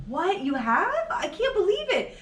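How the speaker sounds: high, incredulous squeal of surprise; mock disbelief. Retelling her reaction to her daughter, mock shocked. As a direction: act shocked and laugh through it. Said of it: "I'm like".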